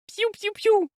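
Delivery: pig squeal. A narrator's reading.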